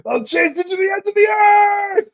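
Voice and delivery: high, loud villain threat; villain shout. Retold or imitated. Fan finally delivering the villain's threat, shouting. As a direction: roar it as the chasing villain. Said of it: "just to attempt it again".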